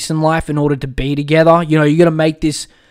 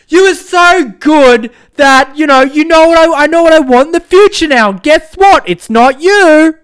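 mocking the ex; 10 semitones higher, 6 dB louder than usual. Host ridiculing the ex's excuse with a loud mocking parody. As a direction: drag out her excuse in a much higher, louder, mocking voice. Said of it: "the worst fucking excuse I have ever heard".

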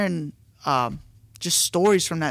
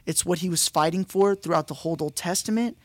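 normal talking voice

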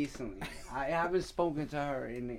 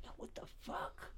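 whispered panic; 17 dB quieter than usual. Retold or imitated. Retelling panic in bed.

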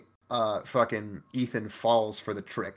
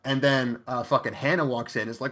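ordinary talk

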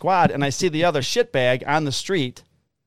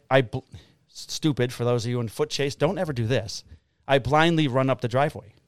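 ordinary delivery

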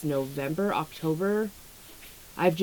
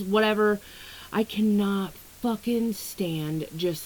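ordinary talk

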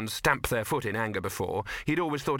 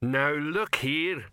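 slow, drawn-out troll voice, a touch higher; troll winding up; 4 semitones higher, much slower than usual. A narrator's reading. Offended troll rounding on the actress, slowly winding up. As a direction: wind up slowly into a troll's protest. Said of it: "his voice winding up like a pitcher's arm".

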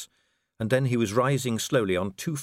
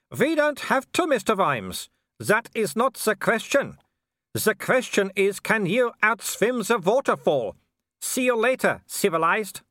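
very high, drawn-out calling voice; taunting werewolf villain; 11 semitones higher, 4 dB louder than usual. Acted. Werewolf taunts Vimes from the bank, high calling voice. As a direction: call out high and slow, savouring each taunt.

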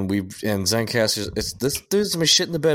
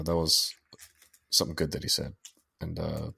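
ordinary delivery

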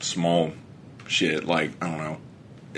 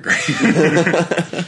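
slow, high laughing words; 10 semitones higher, 4 dB louder than usual. Real, not acted. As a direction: laugh it out slowly, drawn out. Laughing about the new bidet.

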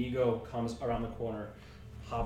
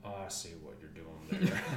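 put-on a touch of low smug old man; 10 semitones lower, 7 dB quieter, faster than usual. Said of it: "and then goes".

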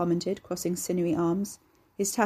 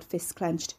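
plain reading voice

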